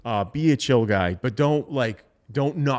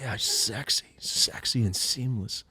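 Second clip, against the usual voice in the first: soft breathy purr; breathy sales voice; 6 dB quieter, slower than usual. Retold or imitated. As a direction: murmur it sensually. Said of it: "like, breathy".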